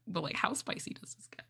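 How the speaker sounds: hushed fast whisper. Real, not acted. Streamer whispering to chat about a spicy script. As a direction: ask it secretly, under breath.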